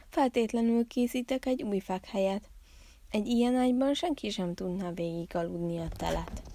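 usual speaking voice

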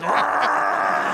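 high wordless yell; hammed-up inarticulate roar; imitated garbled shout; crude mock roar. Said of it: "Helen Keller's like".

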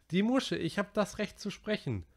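put-on high, worried boy's voice; Piggy's voice. A narrator's reading. Narrator voicing Piggy to the boys, high and worried. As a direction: voice the anxious fat boy.

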